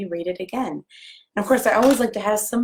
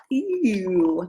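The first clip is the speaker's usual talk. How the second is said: slow, gentle rhyme reading; reading to children; slower than usual. Acted. Librarian reading a picture book rhyme to children. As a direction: read the verse lovingly to little ones.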